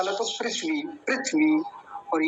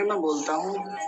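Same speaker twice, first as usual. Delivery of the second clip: ordinary delivery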